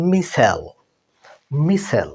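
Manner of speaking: মিসেল said the American way, short MIS-el; মিসেল, American style, said twice; American pronunciation. Shown as a demonstration. Teacher modelling American মিসেল for students. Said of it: "American ইংলিশে এটাকে বলা হয়".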